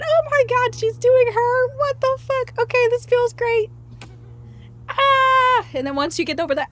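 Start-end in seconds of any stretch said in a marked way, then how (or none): 0.0-5.7 high, giddy amazement; mock amazed. Mimicking someone overwhelmed by bliss, high and giddy. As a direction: gasp it out, thrilled and shocked. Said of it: "I'm just gonna let you do you".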